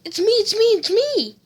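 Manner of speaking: calm her down, pleading fast; urgent pleading. Acted. Son reassuring screaming mom.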